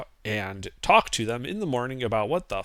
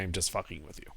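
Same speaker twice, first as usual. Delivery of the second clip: smug; 7 semitones lower, 15 dB quieter than usual. Real, not acted. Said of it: "The, the tone of voice is so smug".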